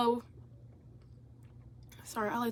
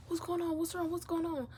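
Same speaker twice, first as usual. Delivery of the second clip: high, soft string of questions; concerned cousin; 8 semitones higher, 6 dB quieter than usual. Retold or imitated. Cousin on the phone asking what happened, concerned. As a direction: ask anxiously in a small high voice.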